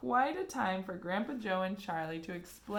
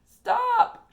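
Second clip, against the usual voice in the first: very loud, high cry; shout; 15 dB louder than usual. A narrator's reading. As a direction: yell to stop it. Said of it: "shouted Grandpa Joe".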